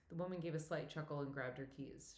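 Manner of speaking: usual speaking voice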